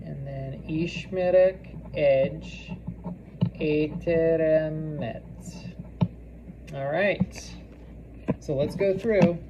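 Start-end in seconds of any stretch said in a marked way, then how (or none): 0.7-5.2 very drawn-out last word; slow dictation. Shown as a demonstration. Teacher dictating the sentence for copying, slowly. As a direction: stretch it out for someone writing.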